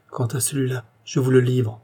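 plain reading voice

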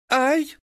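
sharp high shriek; a piercing yelp of pain; пронзительный визг. A narrator's reading. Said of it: "пронзительный визг". (пронзительный визг боли)

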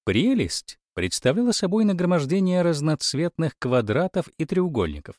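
usual speaking voice

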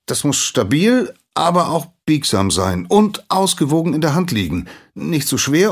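high, loud, eager rush of an answer; eager recital. Acted. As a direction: show off everything he knows.

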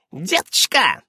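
high, sudden exclamation; mild cry in a high voice. A narrator's reading. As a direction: call out abruptly to a child. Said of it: "вдруг вскрикнула Ларисса".

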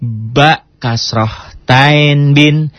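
"bin" drawn out slowly; slow spelling drill. Shown as a demonstration. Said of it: "bi, bi, in gitu ya".

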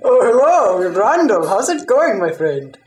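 usual speaking voice

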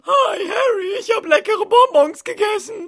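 put-on a dim, happy boy bragging about candy; silly Neville voice. Neville cheerfully tells Harry he ate rat poison.

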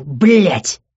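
forceful outburst of swearing; angry curse. A narrator's reading. Man jumps up from spilled juice, angry curse. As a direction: spit out the swearword angrily. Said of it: "закричав, он вскочил".

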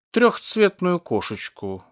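slow, savoured words; savouring explanation. A narrator's reading. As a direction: stretch it out with relish. Cat explains with relish.

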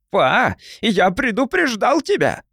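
high, slow bratty yell. Acted. The boy yells a warning at his father after being hit. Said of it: "закричал младшенький дурным голосом".

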